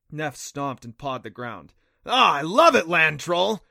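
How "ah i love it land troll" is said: much louder and higher, a delighted whoop; excited cheer. Acted. The Kelpie cheering the blasting plan, loud excited whoop. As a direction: shout it with glee to go.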